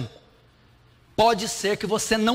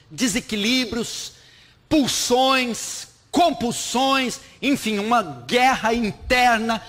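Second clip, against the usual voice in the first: louder, higher, slow and emphatic; preaching at full force; 5 semitones higher, 4 dB louder, slower than usual. Acted. Pastor hammering the list to the congregation, loud and slow. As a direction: drag out each word like a list of accusations.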